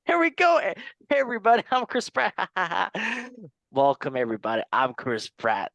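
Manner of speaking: high, excited celebrity host voice; Chris Pratt impression. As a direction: pitch up like a hyped movie star. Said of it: "Chris Pratt, here you go".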